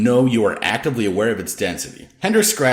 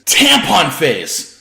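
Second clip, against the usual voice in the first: slow, loud, higher jeering call; belted taunt; 7 semitones higher, 7 dB louder, much slower than usual. A narrator's reading. Lori mocks the anchor with a slow, loud, belted taunt. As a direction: belt the nickname out to mock her. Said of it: "Lori belted out".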